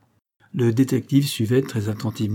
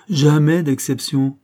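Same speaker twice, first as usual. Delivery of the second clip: slow, louder and higher, every syllable pressed; indignant insistence; 5 semitones higher, 5 dB louder, slower than usual. Acted. Fussy old clerk insisting to the detective, indignant. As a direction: hammer home that there is never an exception.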